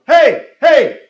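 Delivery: loud, high accusing shouts; yelling. Retold or imitated. Man yelling at a supposed pickpocket, loud shout. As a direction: yell to stop a thief. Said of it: "he started yelling".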